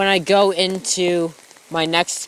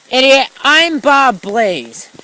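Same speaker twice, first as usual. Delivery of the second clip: mock yell; 6 semitones higher, 6 dB louder, slower than usual.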